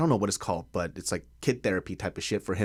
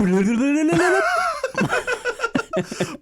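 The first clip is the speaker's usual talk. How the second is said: laughing; 3 dB louder than usual. Real, not acted. Joking about handsome friend.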